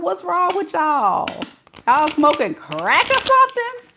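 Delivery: high-pitched comic disbelief; mock incredulous scolding. Teasing people who drive to Disney, high mock incredulity.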